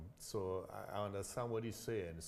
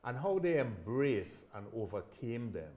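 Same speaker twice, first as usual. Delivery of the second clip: upbeat host announcer read, higher and louder; podcast intro; 5 semitones higher, 9 dB louder than usual. Acted. Host welcoming listeners to his show, bright announcer read. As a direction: sell the show's welcome like a radio host.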